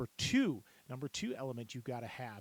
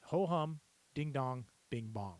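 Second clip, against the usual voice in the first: tone-finding phrase. Shown as a demonstration. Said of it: "use this phrase".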